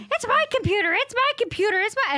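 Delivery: put-on high, loud squeal of quarrelling children; squabbling kids. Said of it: "physically in a fight".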